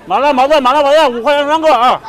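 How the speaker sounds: loud, high vendor cry; street hawking call. Pretend. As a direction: shout the deal to the crowd. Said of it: "帮大姐吆喝吆喝啊".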